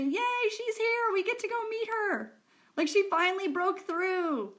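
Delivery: high, slow, thrilled little voice; high cheery voice. Pretend. The universe's energy cheering her arrival, high and slow. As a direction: squeal it out like delighted tiny helpers.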